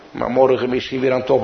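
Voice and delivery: usual speaking voice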